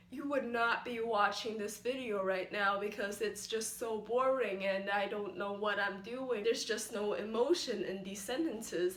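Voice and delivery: emotionless robot monotone; demonstrating a dull, even, robotic drone; robotic first tone. Shown as a demonstration. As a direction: hold every syllable on one level pitch, no emotion. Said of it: "in a purely robotic, just first tone".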